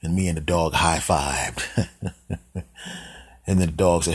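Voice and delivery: ordinary talk, nothing marked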